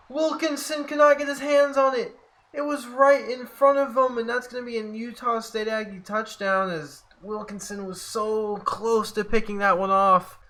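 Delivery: high-pitched exasperated commentary; dismayed play call. Real, not acted. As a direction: cry out at a near miss. Missed interception gives up touchdown.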